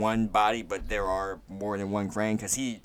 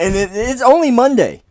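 exasperated; 13 semitones higher, 14 dB louder than usual. Real, not acted. Exasperated about spoilers.

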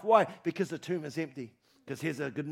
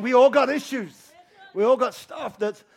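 louder, higher preaching burst; fervent preaching; 6 semitones higher, 11 dB louder than usual. Real, not acted. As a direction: push it out with conviction. Preacher rising in fervor.

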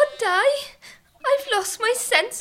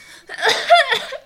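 dramatic cough; 3 semitones higher, 6 dB louder than usual. Acted. Coughing fit, lost sense of smell.

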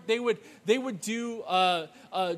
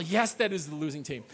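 ordinary talk, nothing marked

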